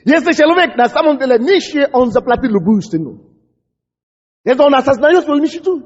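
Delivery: usual speaking voice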